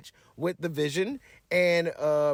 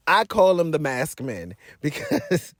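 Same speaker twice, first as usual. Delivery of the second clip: laughing; 3 semitones lower than usual. Real, not acted.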